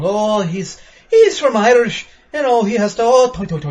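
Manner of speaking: high, loud mock Irish lilt; sing-song Irish accent. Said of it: "like the sing-song".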